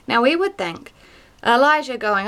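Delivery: ordinary talk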